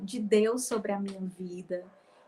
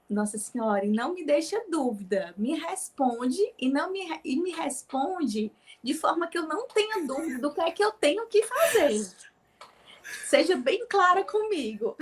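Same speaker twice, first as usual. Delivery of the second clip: rising, louder, urgent plea; pleading prayer; 6 semitones higher, 3 dB louder than usual. Retold or imitated. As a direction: beg for a clear answer, climbing higher. Pleading prayer to Our Lady.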